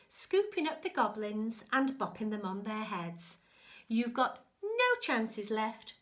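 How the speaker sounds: a bossy good fairy scolding; stern fairy. Acted. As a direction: scold the rabbit like a fussy fairy. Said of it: "and said".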